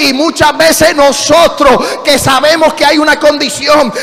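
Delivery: loud, high pulpit shout; full-force preaching. Real, not acted. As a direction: drive it home at full volume.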